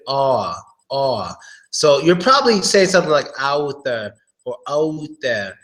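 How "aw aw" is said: aw with the jaw dropped; open aw sound. Shown as a demonstration. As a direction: drop the jaw on aw. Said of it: "open your jaw a little bit".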